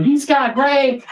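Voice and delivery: high, drawn-out excited crowd cry; crowd screaming. Retold or imitated. Crowd cheering the man running to the stage, high excited cry. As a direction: spread the news in a high, slow holler.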